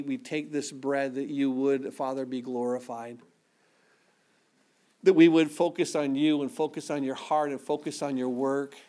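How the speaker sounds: low, soft, unhurried prayer voice; congregational prayer. Acted. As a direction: pray quietly over the bread and cup. Closing prayer over communion.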